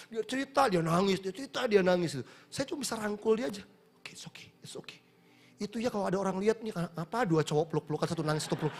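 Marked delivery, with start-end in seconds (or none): 4.0-5.0 hushed, barely voiced reassurance; consoling whisper. Pastor hugging a weeping man as he repents, consoling whisper. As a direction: whisper comfort to someone crying.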